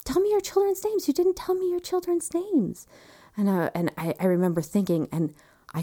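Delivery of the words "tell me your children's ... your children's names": very high, thin distant calling; far-away voice. Retold or imitated. Anesthesiologist to unresponsive mother, high far-away call. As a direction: call from across a valley, pitched way up. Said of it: "it seems very far away".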